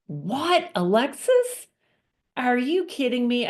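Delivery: husband on the phone, stunned by his wife's confession; shocked husband. Retold or imitated. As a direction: react like you can't believe your ears. Husband hears shocking news.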